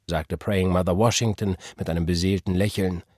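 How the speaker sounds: usual speaking voice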